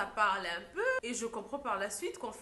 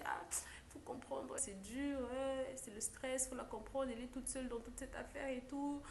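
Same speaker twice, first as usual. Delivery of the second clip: soothing mother; 3 semitones higher, 7 dB quieter than usual. Retold or imitated. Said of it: "la maman me dit".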